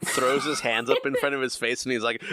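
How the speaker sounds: ordinary talk, nothing marked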